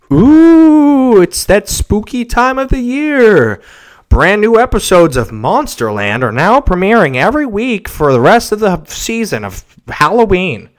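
high, loud, hammed-up spooky ad pitch; mock podcast host belting out an ad script, drawn-out spooky ooh; podcast ad read. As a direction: oversell it like a hokey Halloween promo. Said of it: "on all the podcasts, what do they do? They go".

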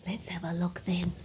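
soft secret whisper. Real, not acted. Whispered to children.

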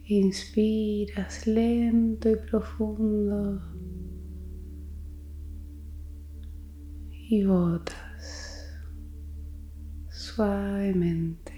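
very slow, drawn-out breathing guidance; slow and gentle, pausing for the exhale; slow breathing cue. Real, not acted. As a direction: stretch each word to pace a long breath. Pacing listeners through slow breaths.

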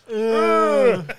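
high held "aah"; loud droning mock groan; imitated loud moan. Retelling the moan that joins the singing, loud held groan.

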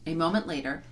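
ordinary delivery, nothing marked

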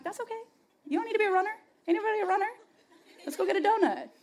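very high, sing-song coaxing voice; mock reassurance. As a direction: coo it in a comically high voice. Mock reassurance to a passing runner.

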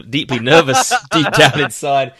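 laughing nervously through the words; loud, jittery laugh breaking in; nervous laughter. Real, not acted. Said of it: "It's nervous laughter right now".

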